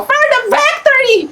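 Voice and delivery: high, excited battle cry; playful voice demo. Shown as a demonstration. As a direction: show off a high voice to be copied. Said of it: "Gayahin mo yung boses ko". (mataas at masiglang boses na ipinagagaya)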